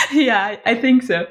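laughing. Real, not acted. Laughing agreement.

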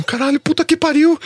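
high-pitched, hammed-up freak-out; acted-out panic. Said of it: "comecei a bater um ataque cardíaco". (pânico encenado, com a voz bem aguda)

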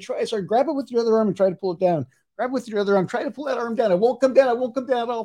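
firm, insistent repeated commands; hypnotic suggestion. Acted. Hypnotist making the volunteer's arm rigid, insistent commands. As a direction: drive the suggestion home, firmly.

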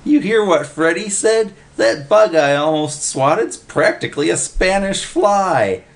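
high, loud, excited holler. A narrator's reading. Willie tells Oscar about the Spanish fly, hollering. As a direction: blurt out the big news. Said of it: "he hollered".

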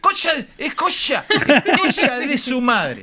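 high shouted curse, stretched out at the end; grito de gol. Shown as a demonstration. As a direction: howl the swear word like a celebrating fan. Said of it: "cómo se gritan los goles en la cancha". (puteada de gol gritada)

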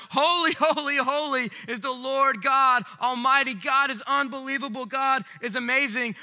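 high, loud, joyful praise; angels praising. Acted. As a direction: sing it out like the angels in awe. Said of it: "there's like".

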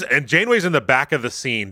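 normal talking voice

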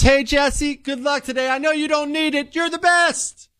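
put-on high, breathy-free gushing girl voice; sweet and adoring; adoring classmates. Girls cheering Jesse on.

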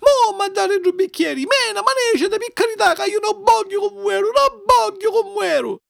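very high desperate wailing; panicked crying out. Acted. Puppet begging the fairy for the glass, panicked. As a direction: beg for your life in a high wail. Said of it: "saccò allora a gridare lo pupu".

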